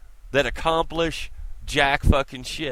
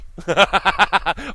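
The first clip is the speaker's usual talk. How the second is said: big open laugh, loud; loud burst of laughter; 6 semitones higher, 7 dB louder than usual. Real, not acted. Vlogger laughing loudly at his own mockery of Tavis.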